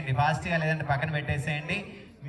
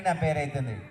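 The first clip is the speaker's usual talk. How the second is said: ordinary talk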